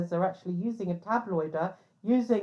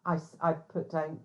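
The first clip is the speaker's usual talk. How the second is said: ordinary talk, nothing marked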